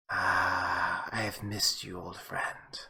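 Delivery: put-on low, hushed vampire greeting to an old friend; vampire murmuring over a sarcophagus; villain whisper.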